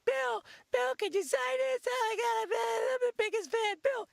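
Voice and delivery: high, squeaky fanboy gushing; high kid fan voice. Retold or imitated. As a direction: gush like an overexcited kid. Comedian imitates fan kid's voice.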